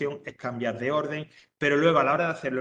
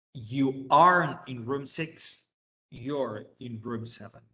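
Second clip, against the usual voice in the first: slow, clear English for learners; careful classroom English, word by word; slow model sentence; much slower than usual. Shown as a demonstration. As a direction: spell it out slowly for the class. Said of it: "teacher models English sentence".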